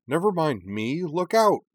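high, urgent shout; shouted warning. A narrator's reading. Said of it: "Karana shouted".

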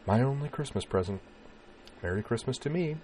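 soft, lifted, wry lightness; wry, hiding pain. A narrator's reading. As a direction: make light of it to cover the hurt. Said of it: "chuckled with a wryness that hid the pain".

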